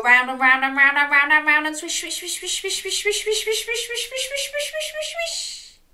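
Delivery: washing machine spinning. Pretend.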